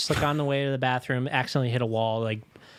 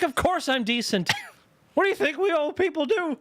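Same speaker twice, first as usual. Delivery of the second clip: mock dad voice; 12 semitones higher than usual. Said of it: "It's like".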